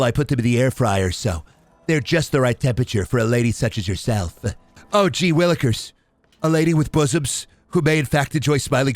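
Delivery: lightly voiced awkward neckbeard, overly formal; a touch of a cringe nerd hitting on a girl; hint of a fedora-tipping dork courting milady; nerdy dork voice. Dork pickup-line character bit.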